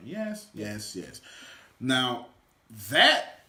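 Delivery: ordinary delivery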